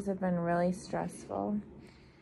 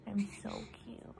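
whisper; 9 dB quieter than usual. Pretend. Mom voicing her newborn.

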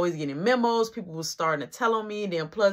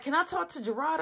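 higher and softer woman's voice; imitating baby mama; 6 semitones higher, 6 dB quieter than usual. Baby mama asking for Gerard, lightly voiced higher and softer. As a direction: ask sweetly in a pitched-up voice.

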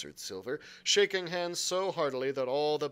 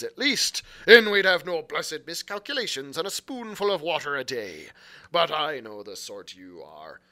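put-on louder pirate brogue, boastful old sea cook; Long John Silver; 8 dB louder than usual. A narrator's reading. Silver boasting to the lad about Flint's crew, pirate brogue. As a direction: swagger it like a one-legged pirate cook.

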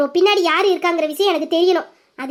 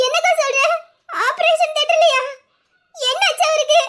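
much higher, tearful alarm; alarmed shock; 7 semitones higher than usual. Acted. A young woman learning her love is in surgery, frightened. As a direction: gasp it out in fear.

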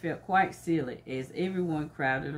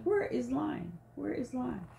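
higher, softer worried calling; friends calling for Lion; 6 semitones higher, 6 dB quieter than usual. Acted. Teacher voicing friends asking where Lion went. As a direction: call out like worried animal friends. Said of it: "his friends are asking".

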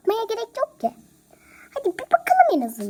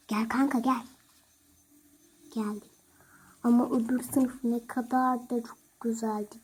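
normal talking voice

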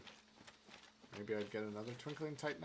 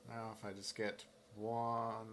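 ordinary talk

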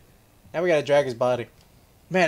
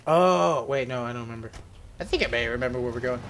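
usual speaking voice

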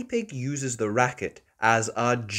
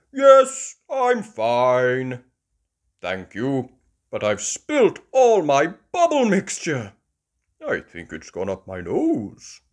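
louder, a touch dazed dad; lightly voiced Daddy Pig; 7 dB louder than usual. Daddy Pig after falling, lightly voiced. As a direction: shrug it off. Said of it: "says Daddy Pig".

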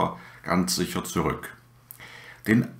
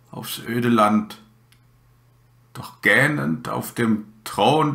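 slow, measured poem reading with long pauses; poem recitation; slower than usual. Acted. Podcast host recites a bleak winter landscape poem to listeners, slow recitation. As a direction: recite line by line, drawing out the words.

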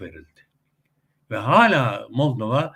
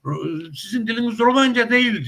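higher, emphatic mock insistence; ısrarcı Rus ağzından; 10 semitones higher, 5 dB louder than usual. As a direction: imitate someone insisting, pitched high. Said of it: "Ruslar diyor ki". (yüksek perdeden, ısrarcı Rus taklidi)

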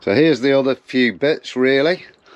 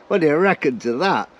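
ordinary delivery

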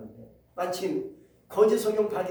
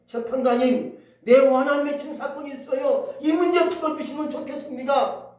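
raised, higher pleading call; loud plea; 6 semitones higher, 7 dB louder than usual. Acted. Widow crying out to the judge, loud plea. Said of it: "외치니까".

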